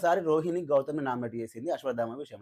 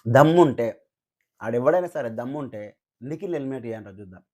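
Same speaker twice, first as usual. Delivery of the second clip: louder, daring దమ్ముంటే with a hard fall; loud dare దమ్ముంటే; defiant challenge; 4 semitones lower, 6 dB louder than usual. Real, not acted. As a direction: throw the dare at the critics. Challenging critics online.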